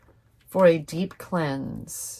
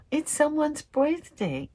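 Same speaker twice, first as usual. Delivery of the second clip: sudden realization; 10 semitones higher than usual. Real, not acted. Gasps at the date.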